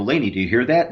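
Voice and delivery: usual speaking voice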